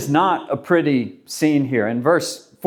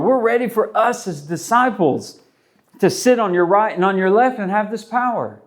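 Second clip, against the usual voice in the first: higher, pushy voice of the keen disciples; eager disciples; 4 semitones higher than usual. Retold or imitated. Disciples eagerly telling Jesus they're ready for power, higher and pushy. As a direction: sell it like a follower hungry for power.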